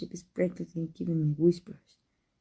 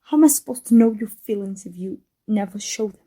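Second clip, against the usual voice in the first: normal talking voice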